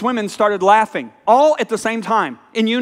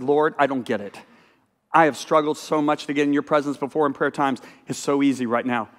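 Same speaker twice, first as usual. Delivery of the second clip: quietly telling the Lord he doesn't get it; soft, wondering prayer, voice dropped low; hushed prayer; 8 semitones lower, 6 dB quieter than usual. Retold or imitated. Praying to the Lord, softer and hushed.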